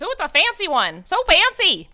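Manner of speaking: high, loud squeaky little lizard-dog merchant; kobold voice. Acted. Kobold stallkeeper calling out to customers, high and squeaky. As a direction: yap it out like a tiny eager kobold shopkeeper. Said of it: "he looks up and goes".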